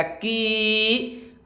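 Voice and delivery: "की" with the ee held very long; long vowel. Shown as a demonstration. Teacher showing students the big ee sign, "की" stretched long. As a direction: draw out the vowel in "की". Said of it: "इसको ज़्यादा खींचना है".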